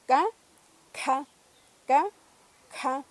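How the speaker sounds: K with a strong puff of air after the k; aspirated k. Shown as a demonstration. Teacher demonstrating an aspirated K to learners. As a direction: release K with a burst of breath. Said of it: "la K aspirada".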